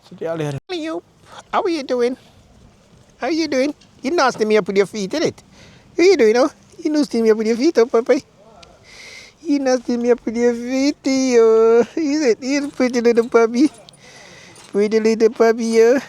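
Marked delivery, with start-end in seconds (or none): 0.7-1.0 soft, high mimicked meow; cat meow imitation. Imitating cat among the kittens. 1.5-16.0 high-pitched cooing at a puppy; pet talk. Real, not acted. Talking playfully to a puppy.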